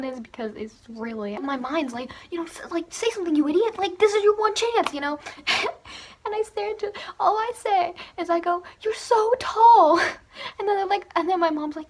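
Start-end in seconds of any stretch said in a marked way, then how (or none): 2.9-4.9 inner panic. Retold or imitated. Inner voice scolding herself. 8.8-10.0 drawn-out, dazed "You're so tall", much higher and louder; starstruck and slow. Retold or imitated. Awkward line to idol.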